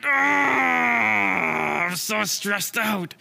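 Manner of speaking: drawn-out exasperated cry; stressed-out wail. Acted. Squirrel hears friends are banned, stressed-out wail. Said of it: "And Sammy went".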